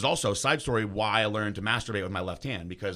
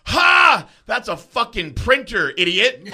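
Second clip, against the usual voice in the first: high, loud, drawn-out jeer; mocking shout; 12 semitones higher, 14 dB louder, much slower than usual. Cop ridiculing the student over a printer, mocking shout. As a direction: bellow it with a scornful laugh up front. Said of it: "Like that".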